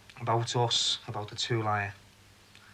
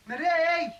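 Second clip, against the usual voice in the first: drawn-out, very high yell for someone; insistent calling; 14 semitones higher, 4 dB louder than usual. Acted. Man calls Marie who hasn't answered, insistent yell. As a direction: call harder, pitch right up, stretching the name.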